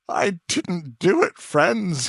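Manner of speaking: accused Potter sobbing to the crowd, high and loud; sobbing plea. Acted. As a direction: beg the friends to believe him. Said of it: "he sobbed".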